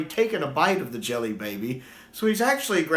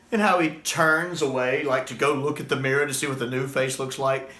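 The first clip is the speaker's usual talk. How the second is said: ordinary delivery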